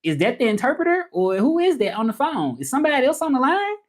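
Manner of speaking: high-pitched, bewildered questioning; confused caller. Retold or imitated. Caller unsure who is on the line, confused. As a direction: imitate a baffled caller, pitch climbing at the end. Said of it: "it was just such a confusion".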